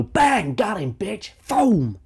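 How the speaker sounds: high yelled gamer cheer; high, loud pretend kill call; mock gamer hype. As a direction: shout it like a game kill. Acting out gunshot kill.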